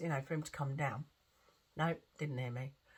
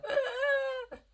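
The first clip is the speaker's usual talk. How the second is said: high, loud strangled gasp; imitated gasp for breath; 6 dB louder than usual. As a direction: mimic fighting to catch breath. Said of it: "as if he was trying to catch breath".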